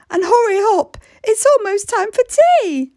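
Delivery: high, firm motherly voice; Mum's voice. Acted. Mum tells giant Ellie to shrink, high and firm. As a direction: send her off like a busy mum. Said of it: "said Mum".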